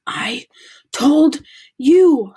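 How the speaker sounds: breathless gasp; out of breath from running. A narrator's reading. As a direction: gasp it out, winded. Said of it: "Hermione gasped".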